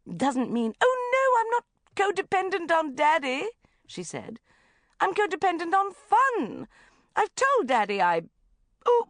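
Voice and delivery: high, loud breathless mum; gushing mother. Acted. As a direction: rattle it off theatrically.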